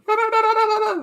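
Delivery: very high "nonono" squeal like [name]. Retold or imitated. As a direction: shriek a rapid high "no-no-no". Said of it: "animation of [name] going".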